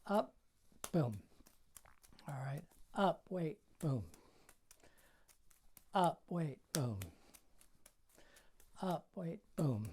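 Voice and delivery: paced count. Shown as a demonstration. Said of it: "you have to bring up and wait".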